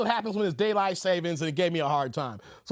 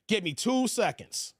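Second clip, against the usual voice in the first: normal talking voice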